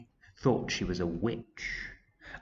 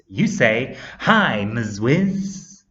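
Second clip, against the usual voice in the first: loud, slow teacherly instruction; firm correction; 2 semitones higher, 8 dB louder, much slower than usual. A narrator's reading. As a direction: spell out the greeting you want, loudly. Teacher firmly correcting the class's greeting.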